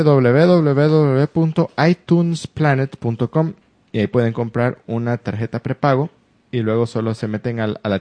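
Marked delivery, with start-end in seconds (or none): 0.0-3.5 slow, loud, careful web address; spelling out URL. Shown as a demonstration. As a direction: dictate the address slowly. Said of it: "reads out a web address".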